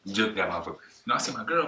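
ordinary delivery

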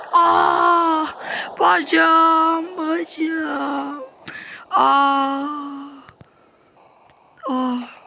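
high loud mock scream of pain; pained cry. Shot in the leg, high hammed-up pain cries.

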